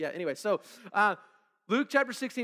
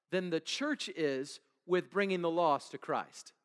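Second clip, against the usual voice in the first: usual speaking voice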